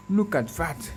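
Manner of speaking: usual speaking voice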